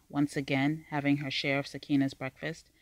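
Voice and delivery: usual speaking voice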